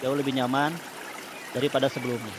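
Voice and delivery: calm guided breathing voice; soothing spa whisper. Acted. Leads relaxation therapy.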